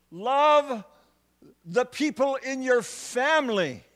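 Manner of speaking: impassioned preacher rising to a peak; full-force preaching. Acted. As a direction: build it like a pulpit climax, hammering each "Love".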